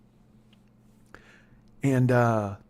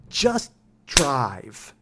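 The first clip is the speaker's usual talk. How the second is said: two words pulled apart, long pause between; drawn-out order. Retold or imitated. Drunk passenger orders the driver to go, drawn-out order. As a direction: imitate a passenger's slow, insistent command.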